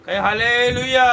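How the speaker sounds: loud drawn-out Hallelujah call; rallying call. Real, not acted. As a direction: call out to the congregation for an answer. Preacher calls for congregation's response. (ज़ोर से hallelujah का आह्वान)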